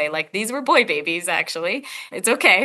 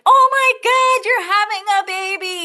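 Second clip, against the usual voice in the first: excited doctor; 13 semitones higher, 6 dB louder than usual. Retold or imitated. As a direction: gush like a thrilled doctor at every birth. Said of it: "was like".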